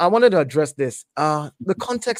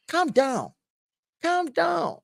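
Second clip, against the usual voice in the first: high-pitched calm down; 10 semitones higher, 4 dB quieter, much slower than usual. Real, not acted. Telling rival fan to calm.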